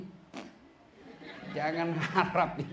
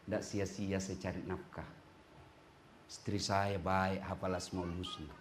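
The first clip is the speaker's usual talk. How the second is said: lower, softer, contented husband murmuring; lightly voiced grateful husband, low and hushed; husband's inner voice; 11 semitones lower, 12 dB quieter than usual.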